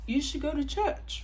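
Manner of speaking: high, loud imitated retort; mock indignation. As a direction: imitate an offended date's parting jab. Retells date's parting jab.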